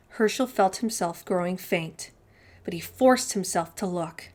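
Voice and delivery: ordinary delivery, nothing marked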